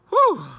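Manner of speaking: high, loud "whew"; exclaimed whew. Real, not acted. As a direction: let out a big high whoop of a whew. Exclaims after recalling the scenery.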